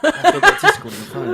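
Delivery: high squeaky laughing voice. Acted. Teasing reply to phone question.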